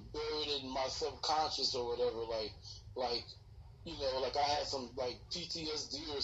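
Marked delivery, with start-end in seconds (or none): none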